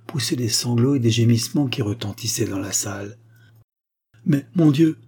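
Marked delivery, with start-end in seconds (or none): 4.3-5.0 high, loud acted cry; distressed exclamation. A narrator's reading. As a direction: cry out in protest, voice lifted. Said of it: "s'écria la marquise".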